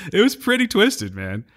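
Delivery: normal talking voice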